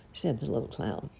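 ordinary delivery

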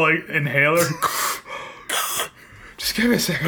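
panting, catching breath. Real, not acted. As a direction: speak while winded. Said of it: "trying really hard not to sound like he's struggling".

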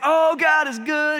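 hammed-up church rapture; overdone joy; mock gushing praise. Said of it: "And you're like".

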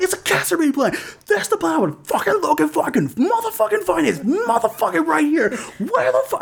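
high-pitched frantic mock yell. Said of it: "I'm, like, yelling".

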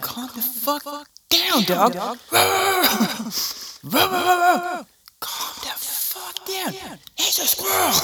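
high, exasperated scolding of the dog; mock scolding. Owner scolding an overexcited dog, high and exasperated. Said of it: "You're like".

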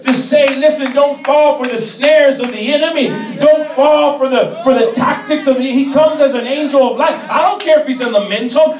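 loud, driving sermon delivery; impassioned church exhortation, projected loud; full-force preaching. Real, not acted. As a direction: preach it hard, punching each phrase loudly.

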